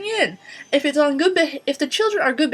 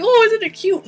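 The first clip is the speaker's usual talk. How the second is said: high, loud mock coo; mocking a parent cooing over a brat; doting parent; 10 semitones higher, 8 dB louder than usual. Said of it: "they're just like".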